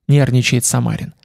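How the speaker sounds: ordinary delivery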